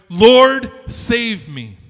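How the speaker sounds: loud, pleading cry as he sinks; desperate cry for help. Acted. Sinking Peter cries out to Jesus, desperate shout. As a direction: yell to be saved from drowning.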